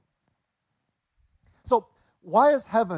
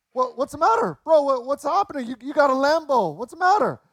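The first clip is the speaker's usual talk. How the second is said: concerned high voices; 7 semitones higher than usual. Retold or imitated. Friends ask crying man.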